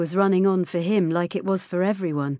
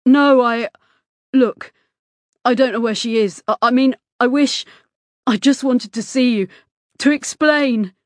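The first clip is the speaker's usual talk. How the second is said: loud, higher, halting and desperate; agitated stammering; 5 semitones higher, 7 dB louder than usual. A narrator's reading. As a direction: plead to be heard, breaking off repeatedly. Distraught boy stammers to explain himself.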